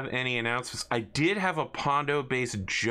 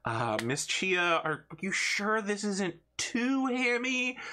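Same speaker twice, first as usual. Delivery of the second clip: slow, much higher hesitant question; timid underling; 9 semitones higher, slower than usual. Acted. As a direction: ask nervously.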